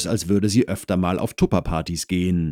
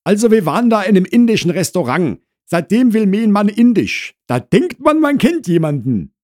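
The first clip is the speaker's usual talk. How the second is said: high, loud housewife chatter; Frau Krause complaining; 14 semitones higher, 8 dB louder than usual. Acted. Wife tells neighbour about husband's new taste, complaining loudly. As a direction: air a marital grievance at high pitch.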